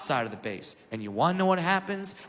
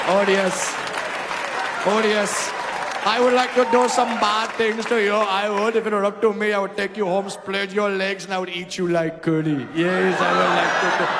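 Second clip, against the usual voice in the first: put-on Indian accent; 3 semitones higher, 5 dB louder than usual. Indian man talking dirty to a woman in Indian accent.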